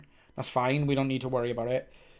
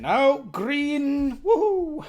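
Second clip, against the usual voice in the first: high, loud happy whoop; excited cheer; 12 semitones higher, 6 dB louder, much slower than usual. Real, not acted. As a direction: cheer as the tests go green. Tests pass, cheering.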